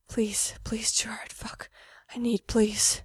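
unvoiced pleading whisper; begging whisper. A narrator's reading. Mikey begging Gerard, whispered pleading. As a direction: plead in a hushed breath.

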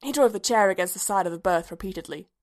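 usual speaking voice

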